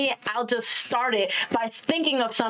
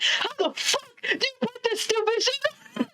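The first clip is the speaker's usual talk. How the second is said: high, strained mock exasperation; hammed-up frustrated whine; imitating frustrated struggle; 9 semitones higher than usual. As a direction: fight with a tricky garment as you speak. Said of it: "Like, struggled to put it on".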